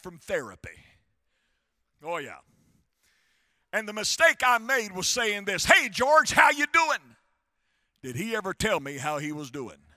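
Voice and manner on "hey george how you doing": high, loud hello to a friend; hearty greeting. Retold or imitated. Pastor greeting the custodian in his hospital room, heartily. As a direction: call out a big, hearty greeting.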